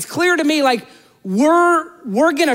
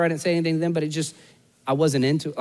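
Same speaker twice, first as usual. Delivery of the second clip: ordinary delivery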